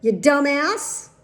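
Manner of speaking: loud, high, drawn-out outrage; imagined yell. Retold or imitated. Patient wanting to yell at the vaper, outraged shout. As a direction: yell it as the rant held back inside. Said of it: "all my energy not to yell".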